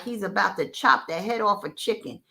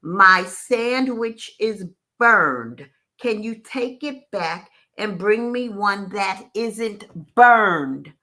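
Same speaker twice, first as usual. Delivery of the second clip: very slow, stern, louder on burned; 5 dB louder, much slower than usual. Retold or imitated. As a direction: spell it out sternly, word by word. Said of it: "He finally said in a stern voice".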